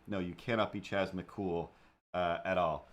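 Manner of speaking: ordinary talk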